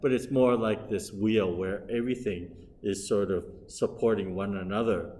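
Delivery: usual speaking voice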